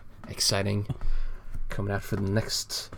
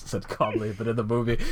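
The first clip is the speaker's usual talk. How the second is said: usual speaking voice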